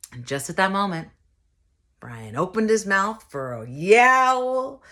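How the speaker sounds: ordinary talk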